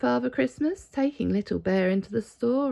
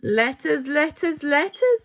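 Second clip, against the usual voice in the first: rising higher and louder each time; building excitement; 7 semitones higher, 8 dB louder than usual. Acted. Father Christmas proudly shows the sorting room, building excitement. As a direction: stack the words up, each bigger.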